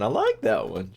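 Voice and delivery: normal talking voice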